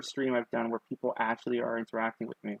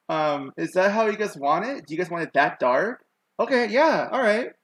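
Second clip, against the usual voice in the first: excited; 6 semitones higher, 6 dB louder than usual. Real, not acted. Reacting excitedly to chat.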